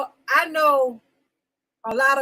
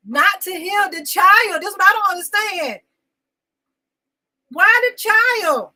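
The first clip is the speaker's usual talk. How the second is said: raised, pained voice, higher and louder; upset outcry; 6 semitones higher, 6 dB louder than usual. Real, not acted. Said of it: "I'm very emotional".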